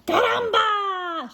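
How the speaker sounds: high, drawn-out caramba shout; chicken's high cry. Acted. Chicken to the nest, crying out high. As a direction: cry it out as an excited hen. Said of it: "she cried".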